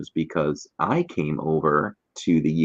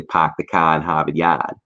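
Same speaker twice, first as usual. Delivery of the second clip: broad Boston, the R dropped so "car" is "cah" and "Harvard Yard" is "Hahvahd Yahd"; Boston accent. Shown as a demonstration. Host demonstrating his mom's Boston accent. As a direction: drop every R like a Boston mom. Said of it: "like my mom would say".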